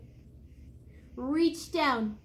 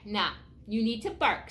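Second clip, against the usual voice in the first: plain reading voice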